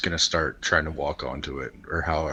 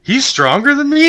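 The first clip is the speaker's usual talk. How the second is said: very high, loud squawk; indignant disbelief; 10 dB louder than usual. Real, not acted. Player hearing the old man is stronger, squawking in disbelief. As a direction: protest as if outrageously insulted. Player protests losing the strength contest.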